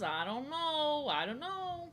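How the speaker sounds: high, slow teasing I don't know; coy singsong dodge. Acted. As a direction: play coy and drag it out.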